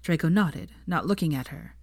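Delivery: usual speaking voice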